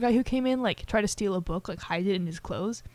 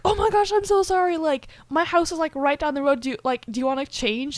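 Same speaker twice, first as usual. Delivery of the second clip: higher, louder, tumbling apology; flustered apology; 6 semitones higher, 4 dB louder than usual. Retold or imitated. William gushing apologies to Anna over spilled juice, flustered and high. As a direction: gush out the sorry, all in a fluster.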